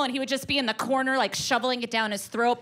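ordinary delivery

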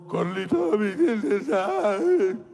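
slow, loud, swooning; mock lovesick sigh. Lovesick boy calling Carlita, slow swooning. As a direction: swoon over her name.

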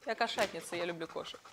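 тихо, себе под нос; quiet aside. Real, not acted. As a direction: say it almost to yourself. Embarrassed aside to herself.

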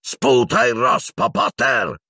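very high, rasping slow yell; furious shout. A narrator's reading. Professor demanding a confession from Potter, furious shout. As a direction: scream it out in rage, drawn out. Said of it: "gridò furibondo".

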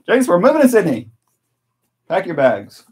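loud, high holler to someone across the house; playful shouted announcement. Pretend. Dad jokingly telling his son they're moving to Sydney, playful holler. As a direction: call it out in mock excitement.

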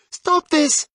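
put-on a girl's urgent cry to halt them. A narrator's reading. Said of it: "Lucy cried".

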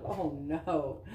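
ordinary delivery, nothing marked

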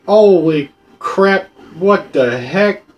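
slow, loud, shocked exclaim; drawn-out disbelief. Real, not acted. Shocked by creepy enemy.

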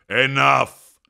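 loud, final bark; thunderclap of finality. Acted. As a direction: slam the word down. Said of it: "his voice like a thunderclap of finality".

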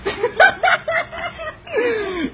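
high laughing fit tailing downwards; long real cackle of amusement; helpless laughter. Real, not acted. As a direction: burst out laughing at the mix-up. Helpless laughter at password mix-up.

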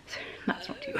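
the voice just cracks away to nothing; lost voice. Real, not acted. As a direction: voice giving out into a whisper. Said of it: "I've lost my voice".

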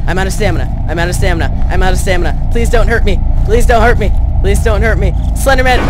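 high, loud frantic panic; begging in real terror while chased; terrified horror victim. Real, not acted. Panicking being chased by Slender.

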